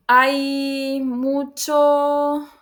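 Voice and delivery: extremely slow, drawn-out example. Shown as a demonstration. Teacher to class, stretching out an example phrase. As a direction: stretch it out word by word. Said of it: "puedo decir".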